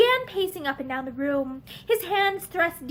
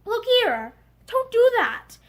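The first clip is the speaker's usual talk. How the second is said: a touch of rough anger over kindness; gruff but caring plea; gruff, angry-sounding; 7 semitones higher, 4 dB louder than usual. A narrator's reading. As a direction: sound cross but mean it kindly. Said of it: "His voice sounded as if he were angry".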